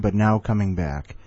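ordinary delivery

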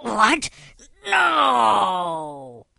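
high shriek into a long wail; horrified villain cry. Acted. Jim learns his data chip is gone, horrified villain cry. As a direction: explode high, stretch the last word out.

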